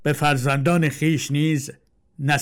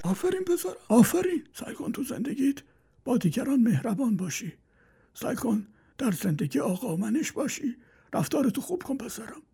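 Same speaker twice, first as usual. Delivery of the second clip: put-on high, soft old man praising; old father praises his son quietly; approving old father; 10 semitones higher, 6 dB quieter than usual.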